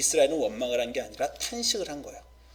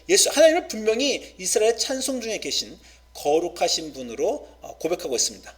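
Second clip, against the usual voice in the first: ordinary delivery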